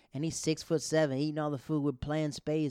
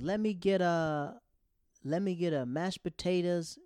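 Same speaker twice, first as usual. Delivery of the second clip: higher, slow, louder order; drawn-out hopeful order; attendant ordering; 5 semitones higher, 5 dB louder than usual. Retold or imitated. A parking attendant ordering from the dad, slow and hopeful.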